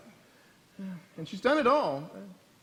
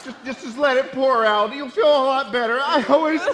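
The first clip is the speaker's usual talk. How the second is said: loud, high tearful urging; sob-laughing encouragement; crying; 7 semitones higher, 11 dB louder than usual. Acted. Felix urging the weeping sisters on, loud tearful crying.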